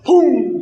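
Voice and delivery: high, sudden gunshot imitation; disparo imitado. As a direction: mimic a pistol going off. (pum repentino de disparo)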